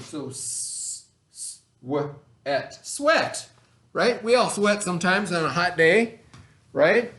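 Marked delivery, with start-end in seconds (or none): 0.0-2.9 sweat chopped into its sounds, s-, w-, et, first part breathed; sounding out. Shown as a demonstration. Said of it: "the E A is making an eh sound". 2.9-3.5 Sweat blended together high and bright with a short eh; blended word. Shown as a demonstration. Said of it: "making an eh sound".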